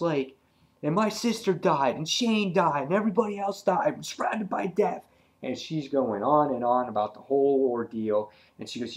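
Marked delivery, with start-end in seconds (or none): 0.8-5.0 high-pitched acted argument, piling up losses; heated comeback. Andrea answering Alan with her own losses, heated. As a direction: fire back the list with raised pitch.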